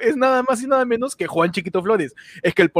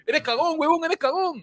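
acted taunt; 5 semitones higher, 7 dB quieter than usual. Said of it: "a decirle".

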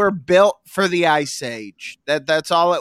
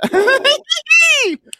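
high shrieking cackle; creepy laugh; 5 dB louder than usual. Shown as a demonstration. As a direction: let out the trademark cackle. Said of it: "boom, every time".

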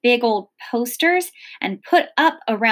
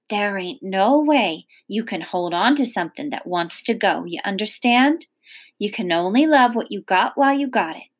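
ordinary delivery

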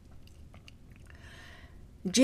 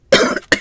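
sharp choking cough; coughing fit; 5 dB louder than usual. Real, not acted. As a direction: cough hard, caught in the throat. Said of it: "I'm over here choking".

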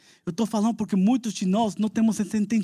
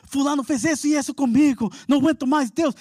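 higher, louder anguished prayer; desperate plea; 9 semitones higher, 4 dB louder than usual. Retold or imitated. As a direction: cry out to God in despair. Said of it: "Você fala assim".

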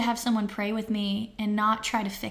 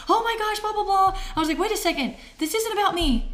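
high-pitched retold panic; freaking out; 9 semitones higher, 3 dB louder than usual. Wife reacting to husband's diagnosis, mock freaking out. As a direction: replay your own freak-out, pitch jumping up. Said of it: "I started freaking out".

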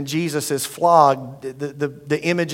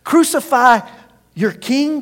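incredulous question; 7 semitones higher, slower than usual. Acted. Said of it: "Pilate asked".

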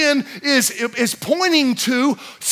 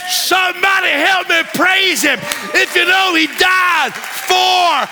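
preaching at full force; 3 semitones higher, slower than usual. Acted. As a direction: shout it to the congregation like a revival preacher.

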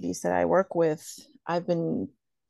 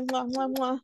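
between kisses; 6 semitones higher, 5 dB quieter than usual. Real, not acted. Blowing kisses goodbye.

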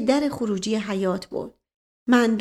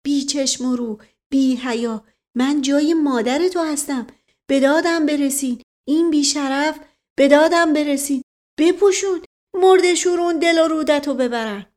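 indignant cries building higher and louder; outraged cries; 5 semitones higher, 5 dB louder than usual. A narrator's reading. Woman fending off a man's advances, crying out. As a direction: cry for help while cursing the attacker. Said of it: "فریاد زن".

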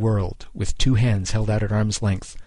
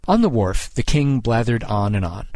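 plain reading voice

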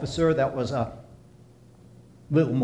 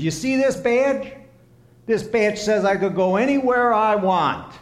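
cocky officer; 6 semitones higher, 5 dB louder than usual. Acted. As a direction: lord the badge over him. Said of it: "And the officer says".

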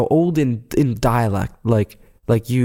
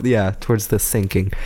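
ordinary talk, nothing marked

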